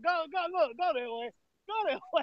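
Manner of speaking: high playful shoo. Real, not acted. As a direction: wave him off teasingly. Shooing brother away, laughing.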